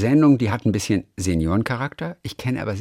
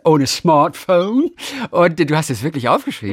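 incredulous; 5 semitones higher, 4 dB louder than usual. Real, not acted. Incredulous emphasis.

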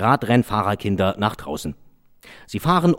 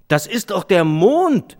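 put-on high, slow, louder child's call; a boy insisting it's the moon; 9 semitones higher, 5 dB louder, slower than usual. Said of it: "rief der Junge". (hoher, langsamer, lauter Kinderruf)

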